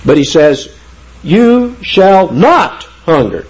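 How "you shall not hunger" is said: "You shall not hunger" slow and high, leaning on "not"; emphatic negative. Shown as a demonstration. Preacher stressing "not" to show a stronger negative, emphatic. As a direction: drive home "not" with weighty, drawn-out words. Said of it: "make your negative stronger".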